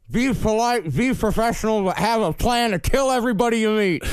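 gruff general, words round a cigar; cigar in mouth. Retold or imitated. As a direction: talk past a fat cigar. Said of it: "do it like you have a cigar in your mouth".

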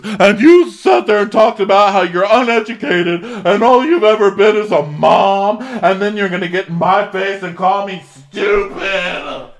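high, loud furious tirade; hammed-up rage at a rival; angry rant. Woman tearing into a rival, mock furious. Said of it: "And she goes".

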